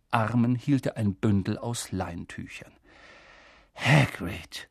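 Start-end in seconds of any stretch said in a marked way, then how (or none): none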